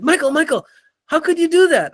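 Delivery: high-pitched, incredulous imitation; astonished colleagues. Coworkers mobbing him in the hall after meeting, mock astonishment. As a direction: mimic amazed coworkers crowding round.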